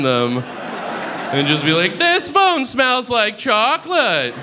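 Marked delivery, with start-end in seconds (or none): 2.0-4.3 high, slowed-down mock complaint; high-voiced silly customer complaint; absurd mock complaint. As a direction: declare it slowly, pitched way up.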